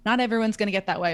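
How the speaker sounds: ordinary delivery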